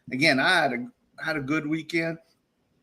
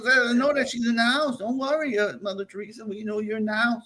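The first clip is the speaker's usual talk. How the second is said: high quoting voice; 7 semitones higher than usual. Retold or imitated. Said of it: "the one says".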